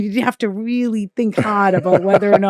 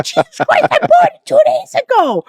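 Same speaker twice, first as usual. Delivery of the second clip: much higher and louder, exasperated yelp; exasperated outburst; 7 dB louder than usual. Retold or imitated. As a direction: shriek it at the pushy mother. Mother demanding debt back.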